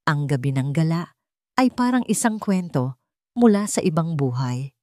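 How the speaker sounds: ordinary delivery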